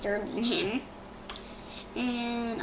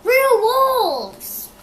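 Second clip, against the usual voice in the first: frightened cry; 12 semitones higher, 11 dB louder than usual. A narrator's reading. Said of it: "he cried".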